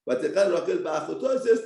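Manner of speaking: usual speaking voice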